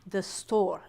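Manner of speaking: ordinary talk, nothing marked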